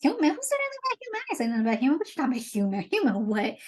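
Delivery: hammed-up indignant disbelief; comic mock anger, not shouted; mock mad. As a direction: play at being outraged, pitch leaping up then dropping. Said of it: "Triton gets all mad. He's like".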